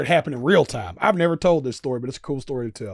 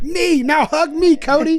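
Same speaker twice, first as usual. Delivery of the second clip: high, loud mock-kid demand; excited little kid; 9 dB louder, slower than usual. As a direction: beg for a hug like an overexcited child. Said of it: "looked like a little kid".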